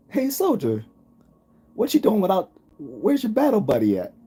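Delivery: high, barking drill sergeant yell; mimicking a drill sergeant. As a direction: catch a soldier without his buddy, sergeant's mock bark. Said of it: "he even sounded like him".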